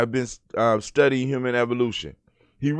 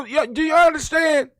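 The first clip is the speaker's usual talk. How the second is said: higher, louder, urgent; urgent plea; 14 semitones higher, 5 dB louder than usual. Real, not acted. Father asking viewers to understand, urgent. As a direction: press them to understand.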